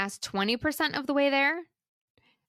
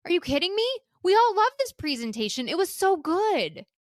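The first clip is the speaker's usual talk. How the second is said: amazed disbelief; 6 semitones higher than usual. Retold or imitated. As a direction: retell her shocked praise, pitched up. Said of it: "And she goes".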